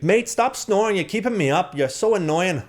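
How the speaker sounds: high-pitched imitation of the annoyed roommate; mock roommate voice. Mimicking his roommate scolding him, high and squeaky. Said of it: "she's like".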